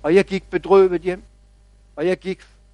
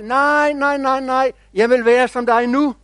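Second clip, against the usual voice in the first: high-pitched, insistent mock yell of protest; impatient shout; 5 semitones higher than usual. Little oak protests to the big oak, impatient shouting. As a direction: cry out like an impatient little one. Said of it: "det råbte".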